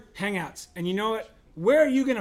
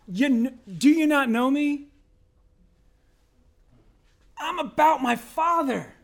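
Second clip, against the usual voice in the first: voicing Jesus; 7 semitones higher, 5 dB louder than usual. Young Jesus to his parents in the temple, earnest and high. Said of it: "He says".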